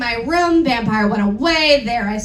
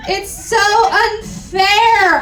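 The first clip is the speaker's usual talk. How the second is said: slow, sing-song whine, higher and louder; whiny complaint; 5 semitones higher, 4 dB louder, much slower than usual. Acted. Heroine complaining to Draco, slow sing-song whine. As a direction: drag it out like a sulky teen. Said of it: "I yielded".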